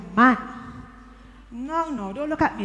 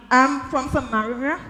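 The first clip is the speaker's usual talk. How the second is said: ordinary delivery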